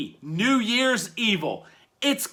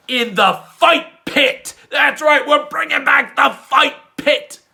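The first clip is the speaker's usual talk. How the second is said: higher, louder hype yell; hyped shout; 8 semitones higher, 5 dB louder than usual. Real, not acted. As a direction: pump the crowd up. Hyping the match hard.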